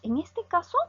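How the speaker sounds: ordinary talk, nothing marked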